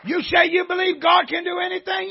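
loud, high, driving shout at the devil; preaching at full force. Acted. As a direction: rail at the devil from the pulpit.

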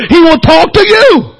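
usual speaking voice

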